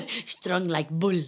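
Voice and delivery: slow mock Russian with a laugh; Russian accent. Echoing co-host's joke, slow Russian accent. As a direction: echo it in a drawn-out Russian accent. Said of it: "I don't think that's a Russian accent".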